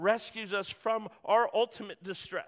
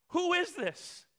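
higher, amazed wonder; awed question; 9 semitones higher than usual. Retold or imitated. Disciples amazed after the storm stops, awed.

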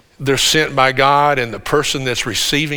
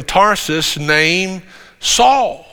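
drawn out; 3 semitones higher, much slower than usual. Real, not acted. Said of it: "emphasizing detail".

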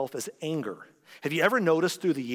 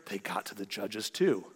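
mock hushed conspiracy whisper; 7 semitones lower, 6 dB quieter than usual. Mocking conspiracy believer.

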